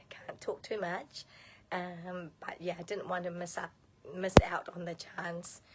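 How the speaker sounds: hoarse, half-whispered lost voice. Real, not acted. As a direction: voice mostly gone, breaking into whisper. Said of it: "I've lost my voice".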